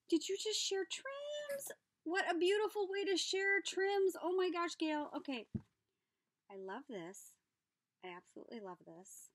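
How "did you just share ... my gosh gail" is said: much higher, thrilled questioning; delighted surprise. Real, not acted. Thrilled at Gail sharing trims, delighted surprise. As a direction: squeal with delight.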